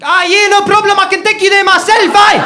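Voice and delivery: put-on loud, high, angry South African accent; mock South African. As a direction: shout percussively in a hammed-up South African accent. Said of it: "by pretending to be South African".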